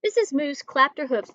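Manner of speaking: ordinary delivery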